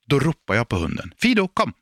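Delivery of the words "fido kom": high call, punch on "Fido"; calling the dog. Shown as a demonstration. (hög inkallning, betoning på "Fido")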